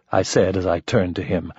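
ordinary delivery, nothing marked

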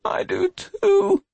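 put-on high, slow, tearful child voice; regretful whimper from an injured boy; drawn-out, high-pitched and pained; weak tearful boy. A narrator's reading. Injured boy regrets what happened.

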